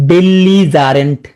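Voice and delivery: "Belligerent" stretched out slowly; slow drill. Shown as a demonstration. Teacher drilling a word taught before, slow and stretched. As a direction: draw out each syllable of "belligerent".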